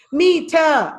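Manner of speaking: METER said loud in two distinct beats; syllable demo. Shown as a demonstration. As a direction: sound out both beats of METER. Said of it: "that is two syllables".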